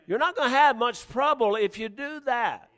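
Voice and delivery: ordinary talk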